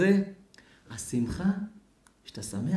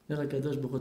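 ordinary talk

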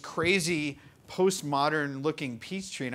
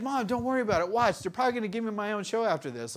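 joking reassurance; 7 semitones higher, faster than usual. Retold or imitated. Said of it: "totally kidding".

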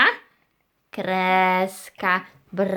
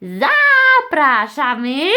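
drawn-out, higher welcoming call; calling out invitation; 4 semitones higher, 5 dB louder, slower than usual. Pretend. Host invites everyone in, calling out slowly. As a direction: stretch the word out as a big invitation.

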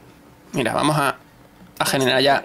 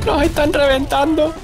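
dismayed wail; 12 semitones higher, slower than usual. Real, not acted. Losing the game badly.

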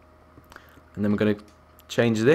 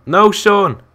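loud, high cry of protest; alarmed scolding; 9 semitones higher, 7 dB louder than usual. Real, not acted. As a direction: shout to stop a friend. Sean breaks the blocks under them.

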